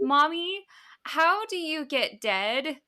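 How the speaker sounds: put-on high, small, slowed child asking; child's voice. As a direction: ask it like a worried five-year-old. Mother voices scared daughter.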